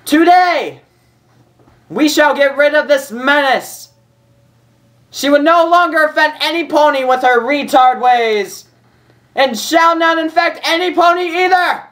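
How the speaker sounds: high, loud furious proclamation; angry yell. Acted. Princess yelling at the crowd in disgust, furious shout. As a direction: bellow it at the crowd in rage. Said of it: "yelled out in an angry voice to the crowd".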